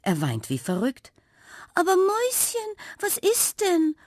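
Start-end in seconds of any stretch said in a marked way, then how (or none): 1.7-3.9 much higher, alarmed mother calling out; a frightened gasp of a mother cat; startled cry. A narrator's reading. Mother cat startled by her crying son, alarmed high call. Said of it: "ruft Napperli erschrocken".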